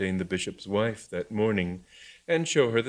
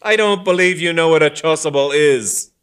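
angry taunt; 8 semitones higher, 11 dB louder than usual. Acted. Heated quarrel.

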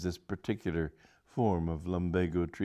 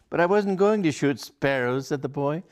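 put-on boy voice; 9 semitones higher, 5 dB louder than usual. A narrator's reading. Boy blurts his real plan.

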